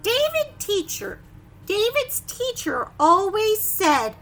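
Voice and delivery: loud, high stern teacher; a touch of a scolding teacher. Acted. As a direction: read it like a strict teacher. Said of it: "It says".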